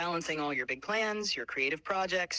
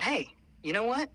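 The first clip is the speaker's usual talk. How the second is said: imitating a scientist; 7 semitones higher, 4 dB louder than usual. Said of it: "some genius at Cornell University thought".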